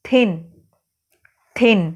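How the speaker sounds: थिन said alone, with a pause after it; demonstrated pronunciation. Shown as a demonstration. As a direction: sound out थिन as a separate word to show its vowel. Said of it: "जब आप इस word को बुलाते हो". (थिन का स्वर दिखाते हुए अलग से उच्चारण)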